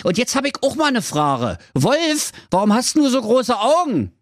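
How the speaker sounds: put-on high, innocent little girl asking. Rotkäppchen questions the wolf. (hohe, naive Mädchenstimme)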